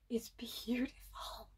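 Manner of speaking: slow, soft whisper of awe; awed whisper. Real, not acted. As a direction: breathe it out slowly, stunned. Moved by the song.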